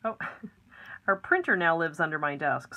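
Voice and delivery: usual speaking voice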